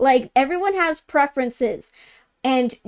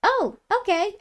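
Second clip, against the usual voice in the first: imitating the stepsister; 8 semitones higher than usual. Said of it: "she's like".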